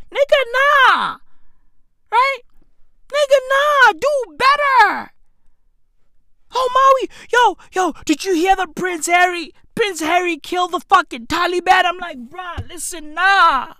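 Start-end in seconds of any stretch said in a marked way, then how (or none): 0.1-5.1 high-pitched comic outrage; exasperated rejection. Real, not acted. Rejecting the fans' recommendation. 6.5-11.8 high-pitched imitation of breaking news; hyped, high-voiced retelling; mock excited gossip. Acts out someone spreading gossip.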